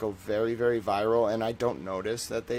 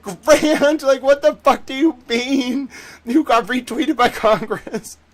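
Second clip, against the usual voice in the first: very high, loud mock tirade; hammed-up screeching outrage of an exasperated editor; imitated yelling; 13 dB louder, slower than usual. Editor berates streamer over a retweet, imitated yelling. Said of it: "he starts yelling".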